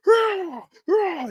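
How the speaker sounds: very high straining roars; mock lifters' screams; imitated gym screams. Gym guys lifting weights, high mock screaming. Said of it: "Everybody's screaming, top of their lungs".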